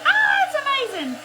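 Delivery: high, loud, quick burst of wonder; mock amazement. Crafter imitating a first-time crackle user, mock amazement. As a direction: squeal it like an awestruck first-timer, hammed up. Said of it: "You'll be like".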